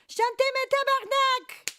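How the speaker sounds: very high, loud mock cursing; playful yelled command. Streamer showing off a phrase learned from Julienne, playful yell. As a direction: yell the order like a rowdy crowd-rouser.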